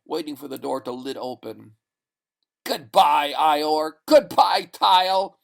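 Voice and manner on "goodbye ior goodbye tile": loud, high triumphant farewell; shouted in triumph. Acted. Man bidding the savages farewell, triumphant shout. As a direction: yell goodbye in victory. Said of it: "he shouted in triumph".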